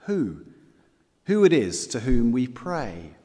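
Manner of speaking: ordinary talk, nothing marked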